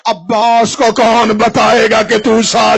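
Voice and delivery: slow, then loud and swelling; slow rising lament. Acted. Husain grieving over telling Abbas, building lament. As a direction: draw it out, then cry it.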